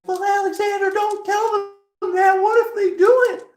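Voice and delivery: very high, loud mock protest; squealed, hammed-up objection; imitated objectors. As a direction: mimic the worriers way up high. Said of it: "They're all like".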